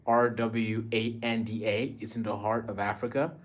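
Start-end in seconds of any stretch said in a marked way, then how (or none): none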